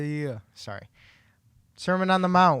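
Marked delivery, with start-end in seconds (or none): 1.8-2.6 louder, right into the mic. Real, not acted. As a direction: speak up into the mic. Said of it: "You gotta talk into the mic".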